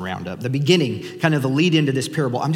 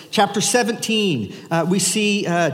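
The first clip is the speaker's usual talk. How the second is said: usual speaking voice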